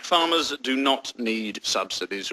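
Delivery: slow, low and soft; deliberate statement. Acted. Spokesman stating policy to interviewer, slow and deliberate. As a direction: state it slowly, word by word.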